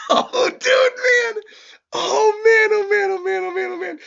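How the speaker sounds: very high, loud squealing disbelief; fan freak-out. Real, not acted. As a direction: squeal it over and over, overwhelmed. Fan freaking out over attack.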